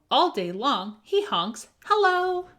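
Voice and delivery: ordinary delivery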